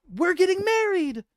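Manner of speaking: very high, loud squeal; excited announcement. Acted. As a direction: blurt the happy news. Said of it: "she says".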